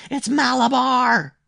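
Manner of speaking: very high, loud, stretched-out scream; powerful strange scream. A narrator's reading. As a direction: scream it slowly at full power. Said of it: "he screamed in a powerful, strange voice".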